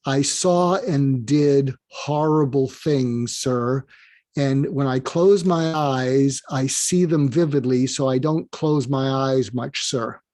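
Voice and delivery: slow, subdued and weighty; measured, heavy retelling of a painful admission; grave confession. Retold or imitated. Marine confessing horrors to his general, grave and slow.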